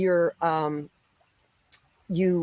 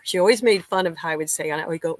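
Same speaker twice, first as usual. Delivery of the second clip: ordinary delivery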